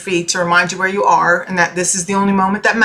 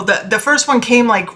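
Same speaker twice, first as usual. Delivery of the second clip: usual speaking voice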